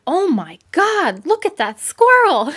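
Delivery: mock the kids gawking at the squirrel; excited kids. Kids spotting the fat squirrel, excited mock gasp. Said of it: "and the kids are like".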